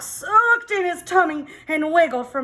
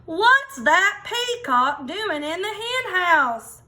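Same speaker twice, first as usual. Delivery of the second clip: higher, slow puzzled farmer voice; 7 semitones higher, slower than usual. Acted. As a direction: wonder aloud like a baffled farmer. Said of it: "asked Farmer Tucker".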